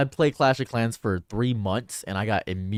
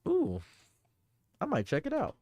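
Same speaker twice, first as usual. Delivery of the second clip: coy interest; 10 semitones higher, 6 dB quieter than usual. Real, not acted. Teasing interest in game.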